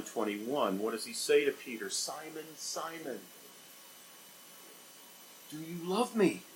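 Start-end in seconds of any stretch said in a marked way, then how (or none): 1.9-6.4 soft, hushed and tender; tender question. Acted. Jesus gently asking Peter, soft and tender. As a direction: ask gently, almost under your breath.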